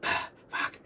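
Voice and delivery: normal talking voice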